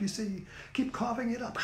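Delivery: ordinary talk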